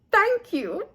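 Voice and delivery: a chirpy Missus Peacock sing-song farewell; high fluttery lady. A narrator's reading. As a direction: trill it like a fussy, delighted lady. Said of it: "Missus Peacock beamed".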